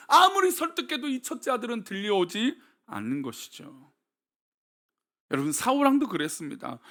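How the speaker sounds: ordinary talk, nothing marked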